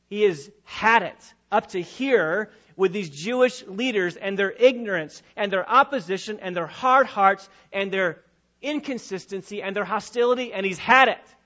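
loud, hammered list of grievances; exasperated, forceful preacher; impassioned preaching. Acted. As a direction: pound out each item from the pulpit.

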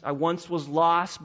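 usual speaking voice

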